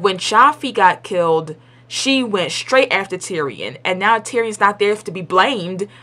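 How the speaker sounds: ordinary talk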